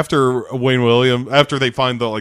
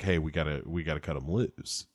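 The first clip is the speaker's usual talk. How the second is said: soft resigned; 7 semitones lower, 14 dB quieter than usual. Retold or imitated. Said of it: "he's like".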